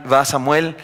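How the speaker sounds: usual speaking voice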